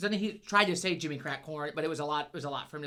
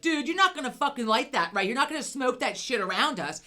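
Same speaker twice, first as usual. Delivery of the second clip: retold outrage; 6 semitones higher, 4 dB louder than usual.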